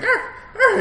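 very high playful arr arr barking; silly high-pitched pretend barking; mock dog barks. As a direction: yap like a man pretending to be a dog. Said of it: "and he's like".

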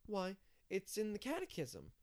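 put-on high child voice; Davy, matter-of-fact. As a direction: explain it like a certain little boy.

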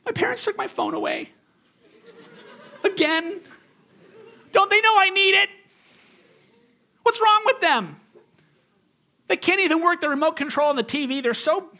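put-on high, loud, indignant teen wail; a hammed-up sulky teen; whiny teenager. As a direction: complain like a wronged teenager.

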